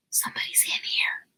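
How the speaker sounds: hushed alarm under the breath; whispered. Acted. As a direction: whisper a warning in the dark. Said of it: "Josh whispered".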